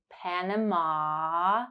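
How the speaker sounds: "Panama" very slow, final ah drawn out; held ah. Shown as a demonstration. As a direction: stretch the last ah of "Panama". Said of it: "ah sound at the end".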